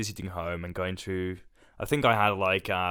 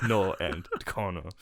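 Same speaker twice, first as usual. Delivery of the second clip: usual speaking voice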